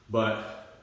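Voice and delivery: usual speaking voice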